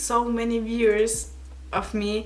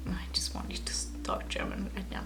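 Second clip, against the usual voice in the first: whisper; 12 dB quieter than usual. Real, not acted. Whispered aside to herself.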